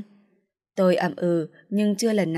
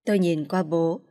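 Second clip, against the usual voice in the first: ordinary delivery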